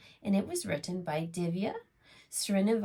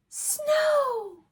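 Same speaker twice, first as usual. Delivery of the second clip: much higher, louder cry of wonder; delighted surprise; 7 dB louder than usual. A narrator's reading. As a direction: burst out at the first snowflake. Sudden first snow exclaimed.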